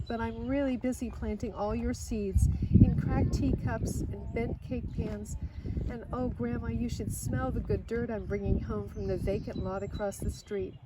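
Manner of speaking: plain reading voice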